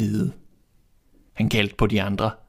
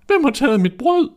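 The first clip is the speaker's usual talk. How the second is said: high, loud little dwarf voice; dwarf's voice; 6 dB louder than usual. A narrator's reading. Third dwarf finding his bread gone, high and loud. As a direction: squeak it out indignantly, high and loud. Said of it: "sagde den tredje".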